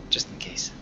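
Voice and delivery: whispered aside. Acted. Joking aside about seat.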